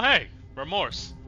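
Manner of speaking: usual speaking voice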